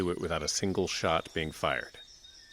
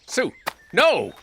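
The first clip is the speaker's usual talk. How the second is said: loud, high cry of alarm; alarmed shout; 11 semitones higher, 11 dB louder than usual. Acted. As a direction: yell to stop her. Sheriff sees Sue step out.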